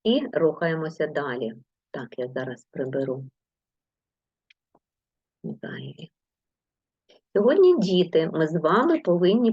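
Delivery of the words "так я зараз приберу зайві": breathy whisper of «зайві» under her breath; whispered aside. Real, not acted. Teacher to herself while clearing the board, whispering.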